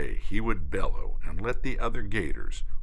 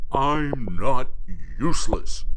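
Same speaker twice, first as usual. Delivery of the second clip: loud defiant bellow; 5 semitones higher, 8 dB louder than usual. Acted. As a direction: roar it out proudly. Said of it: "and then he bellowed".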